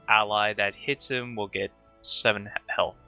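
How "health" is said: usual speaking voice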